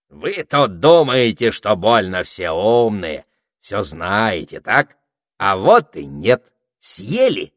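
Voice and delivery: loud, taunting triumph; cocky, emphatic crowing; triumphant gloating. A narrator's reading. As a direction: rub it in with relish, leaning on key words. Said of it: "торжествующе провозгласил Этельред".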